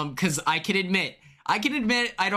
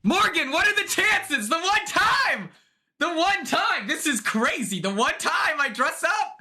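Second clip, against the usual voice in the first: excited disbelief; 9 semitones higher than usual. Real, not acted. Excited about coincidence.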